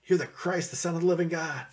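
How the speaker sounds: high-pitched burst of revelation; excited declaration. Acted. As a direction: blurt it out like a sudden discovery. Acts Peter's excited confession.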